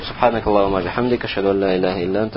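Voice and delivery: rapid, low murmured Arabic prayer; closing supplication. Real, not acted. Teacher closes the grammar lesson with a supplication, rapid low recitation. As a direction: recite it quickly and softly, as a routine formula.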